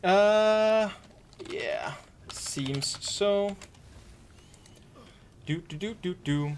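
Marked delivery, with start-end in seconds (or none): none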